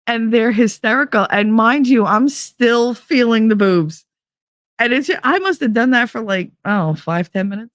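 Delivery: ordinary talk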